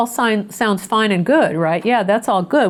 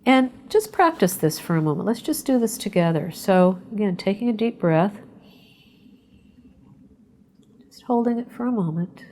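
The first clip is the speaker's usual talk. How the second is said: guided meditation. Acted. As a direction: ease the listeners into calm, breath by breath. Leads a short breathing meditation.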